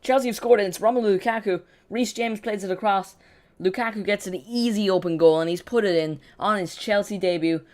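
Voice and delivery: loud, high, excited football commentary; excited goal call. Real, not acted. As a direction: belt out the goal news to the fans. Live call of Chelsea scoring.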